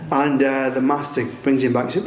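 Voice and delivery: ordinary talk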